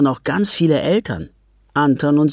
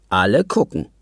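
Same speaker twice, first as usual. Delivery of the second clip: usual speaking voice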